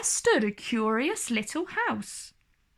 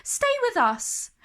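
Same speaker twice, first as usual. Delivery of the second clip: much higher, friendly offer; kind dwarfs; 12 semitones higher than usual. Acted. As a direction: invite her kindly. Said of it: "they said".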